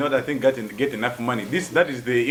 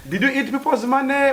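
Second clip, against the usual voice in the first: teasing accusation; 10 semitones higher than usual. Acted.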